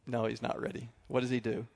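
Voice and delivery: ordinary delivery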